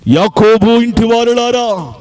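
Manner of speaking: forceful preaching. Acted. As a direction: call it out to the whole church. Proclaims scripture verse. (బలంగా ప్రకటన)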